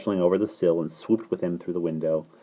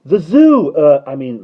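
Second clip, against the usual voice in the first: high, loud start, then faltering; eager boy catching himself; blurting child; 9 semitones higher, 13 dB louder, slower than usual. A narrator's reading. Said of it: "Michael broke off hurriedly".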